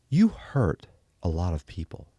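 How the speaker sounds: lightly voiced TV interviewer; a measured, accusing hint of the newsman; interviewer impression. Imitating the interviewer pressing him on camera, lightly voiced accusation. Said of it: "he would go like that".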